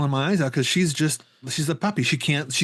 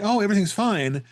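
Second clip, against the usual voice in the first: higher, put-on all's-well voice; falsely reassuring; 7 semitones higher than usual. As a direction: imitate her covering up with a lie. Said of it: "she's lying to him saying".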